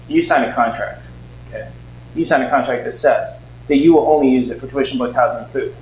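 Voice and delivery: stern officer scolding the student; stern loan officer. Retold or imitated. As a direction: set the student straight, firmly.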